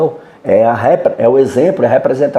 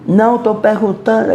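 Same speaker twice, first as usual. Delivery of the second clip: high mock yell, imitating the woman; retold shouting reply; 10 semitones higher than usual. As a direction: snap back as the shouting neighbour. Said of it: "ela respondeu de novo gritando". (imitando grito agudo)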